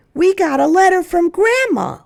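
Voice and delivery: put-on high, loud excited child voice. Children to the family, thrilled and loud. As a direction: burst in with big news.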